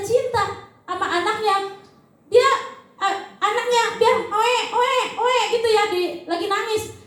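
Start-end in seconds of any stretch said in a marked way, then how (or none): 4.3-5.4 baby cry imitation.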